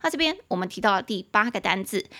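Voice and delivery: usual speaking voice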